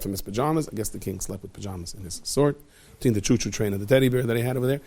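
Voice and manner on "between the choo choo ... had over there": usual speaking voice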